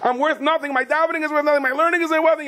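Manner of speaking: high, hammed-up wailing complaint; mock self-pity. Man despairing his learning is worthless, mock self-pity. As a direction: lament it in an exaggerated high voice.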